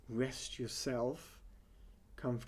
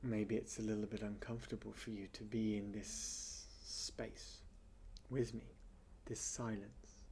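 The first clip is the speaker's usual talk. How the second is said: guided meditation; 7 dB quieter than usual. Real, not acted. Teacher easing listeners through silence to quieten the mind, meditation voice. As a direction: settle listeners into stillness, softly and unhurriedly.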